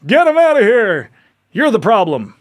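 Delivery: mock the crowd yelling to throw him out, with laughs; mocking angry crowd. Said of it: "you go".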